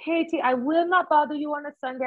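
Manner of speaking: high, quick mock Jamaican accent. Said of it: "it sounds like a Jamaican accent".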